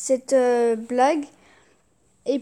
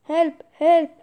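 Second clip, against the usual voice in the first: calling for help; 3 semitones higher, 6 dB louder than usual. Acted. Drowning man calling out.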